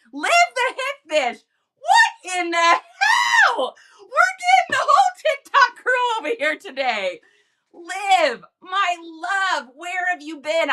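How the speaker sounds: high, loud excited squeal. Real, not acted. Streamer greeting TikTok friends arriving in chat, high excited squeal. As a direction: squeal with delighted surprise.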